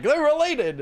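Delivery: high-pitched mock quote; squeaky-high retold reaction; mimicked outside voices. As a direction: jump up high to imitate people jumping to conclusions. Mimics what other people say.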